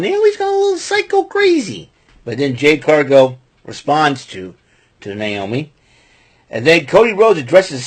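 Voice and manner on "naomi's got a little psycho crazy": mock psycho voice. Describing Naomi's craziness.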